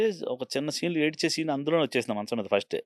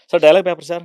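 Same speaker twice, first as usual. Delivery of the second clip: louder, pressing actor asking "sir dialogue paper sir?"; eager actor asking; 3 semitones higher, 11 dB louder, faster than usual. Retold or imitated. Actor presses the director for the dialogue paper, louder. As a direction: push the question at the director.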